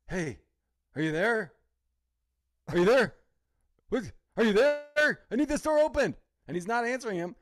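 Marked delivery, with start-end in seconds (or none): 0.1-6.1 building from plain to loud insistent calls; impatient boss calling. Retold or imitated. Heist boss shouting into the mic, louder each time. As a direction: keep calling louder, he won't answer. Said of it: "the main guy's like".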